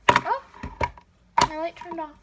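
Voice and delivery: sudden high yelp of surprise; startled. Real, not acted. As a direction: caught off guard by the light dying. Light suddenly goes off.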